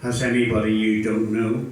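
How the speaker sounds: low, measured official question; a hint of airport interrogator, pitched low; lightly voiced security officer. Security officer questioning a traveller, lightly imitated in a low voice. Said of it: "Questions like".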